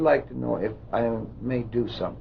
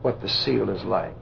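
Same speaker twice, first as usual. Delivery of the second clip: hypnotic induction; 4 dB quieter than usual. Real, not acted. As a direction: lull the subject down gently. Hypnotist leads trance induction.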